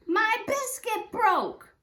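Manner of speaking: high, loud, slow whine; child crying about a biscuit; upset wail. Acted. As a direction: wail over the broken biscuit.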